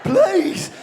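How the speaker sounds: high, loud pleading squeak; voice shooting up. Acted. Lad begging in bed, voice shooting up like a child's. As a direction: plead with the voice leaping up by accident. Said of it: "when your voice goes up by accident".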